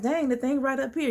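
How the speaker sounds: high, exasperated retold complaint; getting mad. Watching the Uber driver's route, mock exasperation. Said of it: "I'm getting mad".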